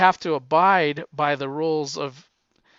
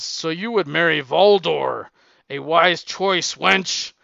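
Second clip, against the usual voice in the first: loud, hammed-up caveman bridegroom; caveman suitor; 7 dB louder, slower than usual. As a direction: boast like a comic-book brute, leaning on the last words. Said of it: "And he says".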